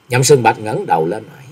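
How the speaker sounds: plain reading voice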